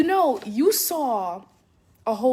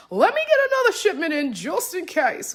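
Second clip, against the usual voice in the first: higher and louder, urgent self-quote; sudden eager decision; 6 semitones higher, 5 dB louder than usual. Retold or imitated. Author recalling her decision to reorder books, excitedly decisive. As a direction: blurt out a decision with excited force.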